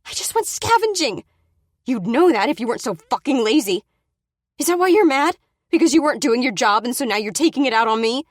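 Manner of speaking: high, loud, accusing shouts; furious yelling. Acted. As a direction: turn the fear into attack. She yells back at Brady.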